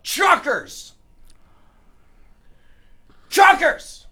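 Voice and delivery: high-pitched sudden mock yell of an outburst; imitated yell. Cohost retelling what a tour goer would yell out, imitated yell. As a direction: blurt it high, like an involuntary shout. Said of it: "she would yell out".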